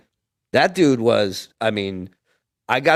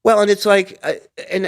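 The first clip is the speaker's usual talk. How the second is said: ordinary talk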